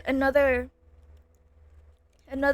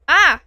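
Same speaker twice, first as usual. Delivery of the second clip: sudden loud yelp of alarm; a real sharp cry as the wafer drops; startled yelp; 3 semitones higher, 11 dB louder than usual. Real, not acted. A loud startled yelp after dropping a wafer.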